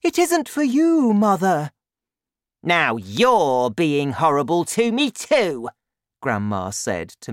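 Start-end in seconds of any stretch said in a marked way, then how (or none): 0.0-1.7 high, loud mother voice; shrieked protest from the daughter; alarmed cry. A narrator's reading. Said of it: "Missus Cranky cried". 2.6-5.7 loud, higher granny voice; loud, indignant old woman; Grandma, aggrieved. A narrator's reading.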